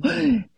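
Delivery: a sharp, high mock gasp for breath; imitating a crying woman's gasp; imitated sob gasp. Acting out the patient's sobbing.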